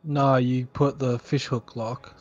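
normal talking voice

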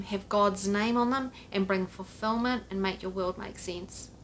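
ordinary talk

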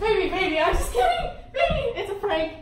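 laughing while revealing the prank; giggling, high and loud. Real, not acted.

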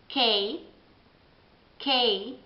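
K said as kay; English K. Shown as a demonstration. Teacher drilling English K. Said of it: "si pronuncia con K".

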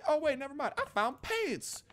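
high delighted discovery; light up at the find; excited surprise. Real, not acted.

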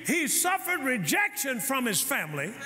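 rising, slowed preaching climax; preaching build. Acted. Pastor building to a climax for the church, slow and high. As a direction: lift the church toward forgiveness.

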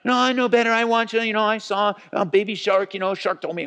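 put-on stubborn little kid. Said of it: "You're, like, five years old".

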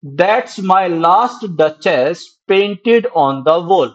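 very slow, each stressed syllable leaned on; slow stressed poem reading. Shown as a demonstration. Teacher reading a poem line to his class, very slow and stressed. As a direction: read the line slowly, marking the iambic beat. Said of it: "यहाँ पे मैंने देखो".

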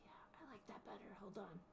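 fast, breathy whispered aside. Real, not acted. Aside while planning the scene.

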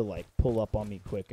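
normal talking voice

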